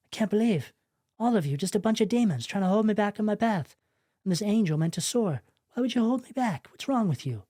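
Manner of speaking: exasperated complaint, acted out in another person's voice; acted upset. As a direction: play someone hurt and accusing. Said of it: "You're kind of upset and you're like".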